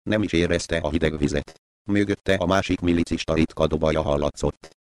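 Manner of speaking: usual speaking voice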